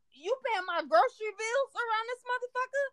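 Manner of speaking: high, incredulous mock-outrage; indignant retort. Clapping back at a commenter about grocery bills, high and indignant.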